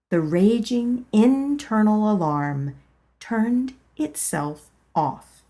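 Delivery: ordinary delivery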